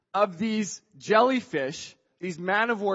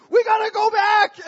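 high, loud yelled order; acted-out warning cry; urgent shout; 12 semitones higher, 8 dB louder than usual. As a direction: holler urgently to the swimmers. Friend yells urgent order back.

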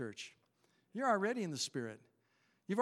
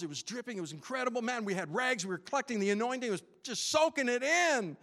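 higher, louder preacher building excitement; fervent preaching; 6 semitones higher, 5 dB louder than usual. Acted. Pastor to church, recalling revival, fervent preaching. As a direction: preach it with full fire, riding the memory.

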